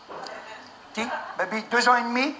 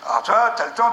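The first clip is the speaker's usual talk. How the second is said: normal talking voice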